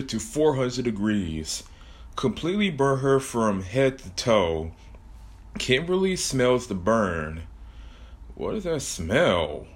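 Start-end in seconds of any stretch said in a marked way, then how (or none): none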